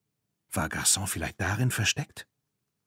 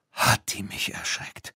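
startled whisper; 3 dB quieter than usual. Acted.

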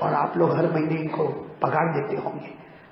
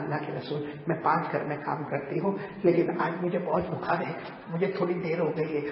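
soft plea; 8 dB quieter than usual. Retold or imitated. Poor widow pleading to Prophet.

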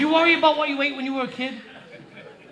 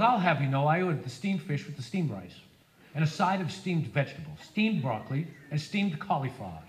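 lower, softer, prissy; prim health nut; 8 semitones lower, 6 dB quieter than usual. Retold or imitated. Comic mimics a smug health nut listing his order. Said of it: "He goes".

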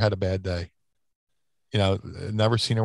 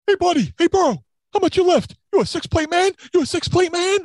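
much higher, louder meathead bro voice; Bruiser Bedlam imitation; 4 dB louder than usual. Wrestler imitating a locker-room bro to his podcast host, high and loud. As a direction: ham up a gym bro sizing you up. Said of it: "our imitation of Bruiser Bedlam".

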